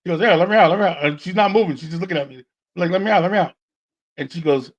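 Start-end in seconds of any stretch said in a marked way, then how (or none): 0.2-1.0 higher, lightly voiced puppet plea; imitated pocket puppet. Puppet pleading from his date's pocket, imitated puppet voice. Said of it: "I hear a noise coming out of her pocket". 2.9-3.5 higher, lightly voiced puppet plea; imitated pocket puppet. Puppet pleading from his date's pocket, imitated puppet voice. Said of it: "I hear a noise coming out of her pocket".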